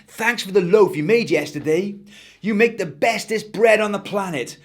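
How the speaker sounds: put-on a keen kid gushing thanks; Eric's voice. Eric thanks the baker.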